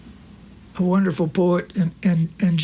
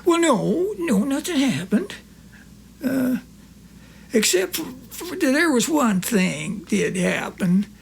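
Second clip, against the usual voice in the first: higher, lightly voiced old country friend; Corky's voice; 7 semitones higher than usual. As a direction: play the innocent old pal.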